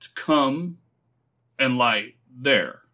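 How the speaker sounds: slow, halting question; spelling it out. A narrator's reading. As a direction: piece the new thought together slowly. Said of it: "spelling out the new thought to himself".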